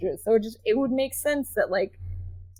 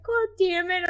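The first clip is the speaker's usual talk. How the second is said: lightly voiced little boy, higher pitch; imitated small son; 9 semitones higher than usual. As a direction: mimic your little son swearing, lightly. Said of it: "One morning he was just like".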